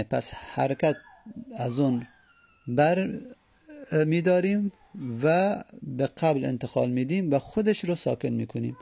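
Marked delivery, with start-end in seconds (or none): none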